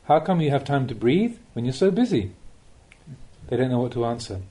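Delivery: normal talking voice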